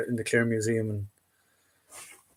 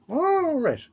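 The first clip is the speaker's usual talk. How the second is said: a sudden much higher, drawn-out "all right"; surprised. Real, not acted. Reading chat's fact about officer caps, surprised high "all right". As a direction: caught off guard by a new fact, pitch jumps way up. Learns red piping means officer.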